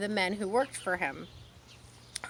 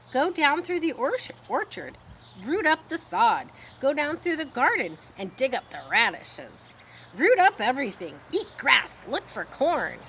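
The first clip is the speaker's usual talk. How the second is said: higher, louder excited goose voice; eager goose; 6 semitones higher, 5 dB louder than usual. Acted. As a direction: cheer him on to run wild. Goose urging pig to roam.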